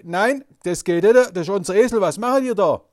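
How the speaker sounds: loud, indignant protest; imitating loud objecting owners; people protesting. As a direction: object loudly that it's their donkey. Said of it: "Die sagen gleich".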